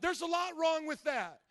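usual speaking voice

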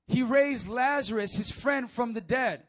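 loud, slow proclamation; forceful preaching. Real, not acted. Preacher drives the point home.

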